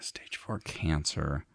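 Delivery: slow, near-whispered echo; hushed pondering. Real, not acted. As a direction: repeat it under your breath, mulling it over. Echoes the strange claim to ponder.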